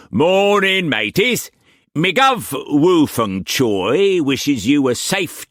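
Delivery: high, slow, loud pirate greeting the crew; cocky pirate. A narrator's reading. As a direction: swagger it to the gaping seamen. Pirate figure voice.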